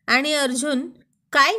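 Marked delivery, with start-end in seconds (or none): 1.3-1.5 loud startled shout. Acted. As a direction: yell it, sitting bolt upright. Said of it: "असं मोठ्याने ओरडत".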